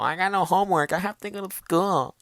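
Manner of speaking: high-pitched schoolkid voice; young kid. Retold or imitated. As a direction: sound like a little kid griping about school. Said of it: "I may be sounding so young right now".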